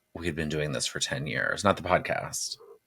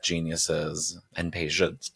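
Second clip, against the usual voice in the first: ordinary talk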